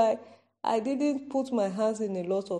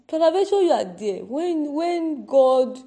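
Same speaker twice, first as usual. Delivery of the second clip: prophetic call; 4 semitones higher, 5 dB louder than usual. Acted. Prophetic warning refrain.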